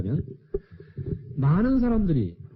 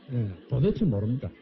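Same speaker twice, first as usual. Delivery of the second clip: ordinary talk, nothing marked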